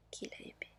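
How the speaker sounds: ordinary delivery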